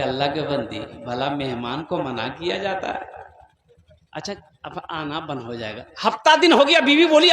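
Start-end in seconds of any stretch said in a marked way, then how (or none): 0.0-5.8 low, soft, resigned mumble; meek husband. Acted. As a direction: placate the wife gently. Meek husband answers wife. (पत्नी को मनाता हुआ धीमा पति)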